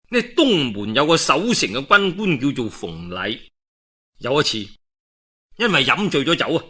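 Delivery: ordinary delivery, nothing marked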